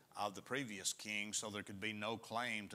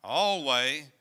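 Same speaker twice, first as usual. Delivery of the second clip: "alway" slow and much louder; stress on alway; 13 dB louder than usual. Shown as a demonstration. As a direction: hammer the word "alway" home. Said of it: "underline that word always".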